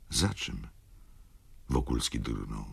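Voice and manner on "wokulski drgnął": usual speaking voice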